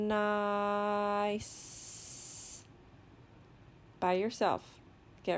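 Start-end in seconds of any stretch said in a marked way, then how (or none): 0.1-2.6 "Nice" with long n, vowel and s; slow sounding-out. Shown as a demonstration. Teacher models a word for children, "Nice" drawn out slowly. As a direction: stretch "Nice" slowly without breaks. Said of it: "saying the word slowly".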